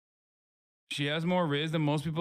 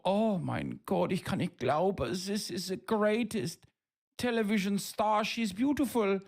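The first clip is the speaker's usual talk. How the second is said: mock German accent; 6 semitones higher than usual. Streamer mocking starstruck Germans, mock German accent. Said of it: "Germans look at an accountant wearing a turtleneck and go".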